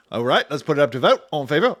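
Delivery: hammed-up, loud, fast British-Australian committee chair; bad British accent. Elementals putting physics to a vote, in a bad British accent. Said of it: "bad Australian and British accents".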